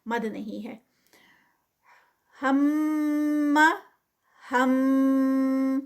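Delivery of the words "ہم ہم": ہم ending in a held nasal hum on the میم; tajweed recitation. Shown as a demonstration. Teacher models ہم with ghunna. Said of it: "اینڈ پہ غنہ".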